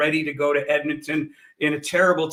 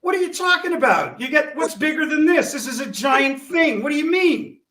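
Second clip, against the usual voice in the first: outraged disbelief; 10 semitones higher, 4 dB louder than usual. Real, not acted. Boss outraged at cancelled gig.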